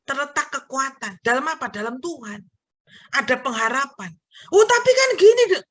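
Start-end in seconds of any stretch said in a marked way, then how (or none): 4.5-5.6 protesting. Acted. Acted objection to the sermon point.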